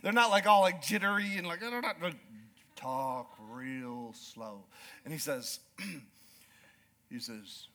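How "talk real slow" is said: very slow, low livestock-man drawl; slow calm drawl. Shown as a demonstration. Describing cowboy speech, a slow low drawl. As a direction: stretch every word like a cattleman. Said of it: "that slow, calm drawl".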